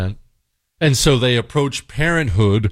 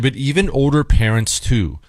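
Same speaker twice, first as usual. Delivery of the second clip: ordinary talk, nothing marked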